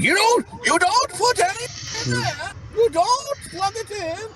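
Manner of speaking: high, hammed-up German accent. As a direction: scold in an exaggerated German accent. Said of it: "that's my fucking German accent".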